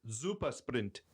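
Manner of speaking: Super Sprint said the German way, with a Z sound at the start; German accent. Shown as a demonstration. Showing his friends how the brand sounds German, in a German accent. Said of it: "if you say it like this".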